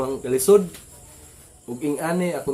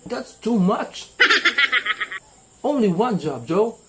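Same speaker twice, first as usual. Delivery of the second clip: much higher, slow laughing English; mock English; 9 semitones higher, 4 dB louder, slower than usual. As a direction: drag it out, laughing at the offer. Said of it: "Ana ko na". (hinay ug hataas nga Ingles nga nagkatawa)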